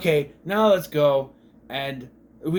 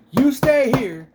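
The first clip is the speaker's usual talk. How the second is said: louder, higher voice for a man giving orders; acted-out order; 8 semitones higher, 7 dB louder, slower than usual. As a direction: act out his firm instruction. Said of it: "he goes, he goes".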